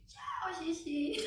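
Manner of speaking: put-on slow, high, sing-song little-girl goodbye; imitating toddler. Mom voicing her daughter saying bye to the flushed pee, slow and high. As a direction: draw it out like a proud three-year-old.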